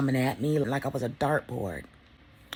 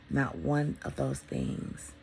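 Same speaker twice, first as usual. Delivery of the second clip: much lower, slow, weight on one; slow low emphasis; 9 semitones lower, much slower than usual. Real, not acted. Telling viewers what she doesn't miss, slow and low. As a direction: linger on each word.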